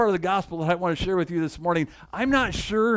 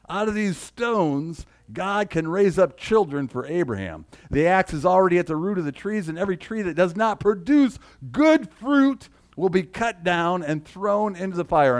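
higher, louder preaching of John's rebuke; forceful scripture reading; 3 semitones higher, 4 dB louder than usual. Acted. Pastor reading John the Baptist's rebuke to the crowd, forceful preaching. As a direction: thunder the prophet's warning.

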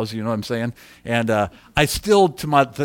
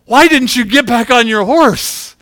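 higher, loud, drawn-out reproach; exasperated scolding; 7 semitones higher, 8 dB louder than usual. Retold or imitated. [name] to friend after the danger, exasperated scolding. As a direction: retell it as a raised-voice telling-off. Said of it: "And I said".

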